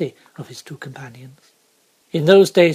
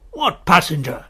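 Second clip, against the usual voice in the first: shouted challenge; 5 dB louder than usual. Acted. Guard shouts back at stranger.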